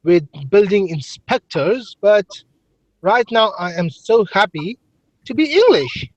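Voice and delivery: half-convincing Englishman gushing about being happy; trying English accent. Acted. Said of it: "Well, you're trying".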